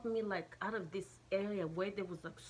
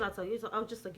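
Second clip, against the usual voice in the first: ordinary delivery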